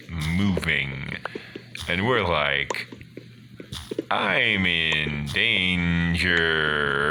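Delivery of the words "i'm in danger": very slow, drawn-out realisation; comic dread. Acted. Players watch the cave entrance move, slow comic dread. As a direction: stretch every word out for comic doom.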